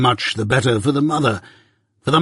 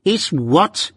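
higher, savage demand; 6 semitones higher than usual. A narrator's reading. Wemmick savagely challenges Mike, a higher sharp demand. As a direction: snap it back at him. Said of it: "demanded Wemmick quite savagely".

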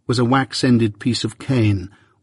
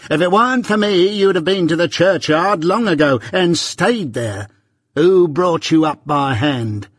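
shrill scolding; 6 semitones higher than usual. A narrator's reading. Mrs Joe berates Pip, high and furious. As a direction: berate him for his ingratitude.

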